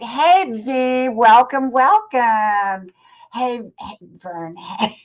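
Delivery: warm, loud welcome; schmoozing. Real, not acted. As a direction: greet a friend arriving, beaming. Greeting a viewer joining live.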